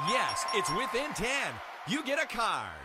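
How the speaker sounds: high, excited win call. Acted. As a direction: cheer the right guess. Win announced in game.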